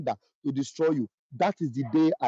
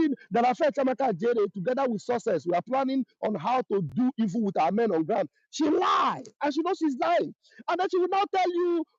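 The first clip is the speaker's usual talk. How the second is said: raised, heated outburst; angry shout; 8 semitones higher than usual. Real, not acted. To fellow Biafrans, calling her a liar in an angry shout. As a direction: shout down her lies, real anger.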